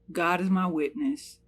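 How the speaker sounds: ordinary talk, nothing marked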